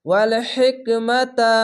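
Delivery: ওয়াল হিকমাতা with the হি breathed out, air released; a breathy, airy হা in হিকমাতা; airy ha in hikma. Shown as a demonstration. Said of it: "বাতাস বের করতে হবে".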